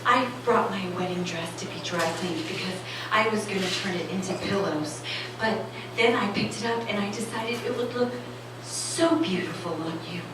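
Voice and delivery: lightly voiced mother leaving a hopeful voicemail; mother's voicemail. Mom on the phone nudging her single daughter, softly sweet. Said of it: "She's like".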